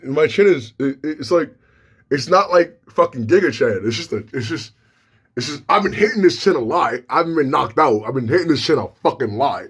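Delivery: ordinary delivery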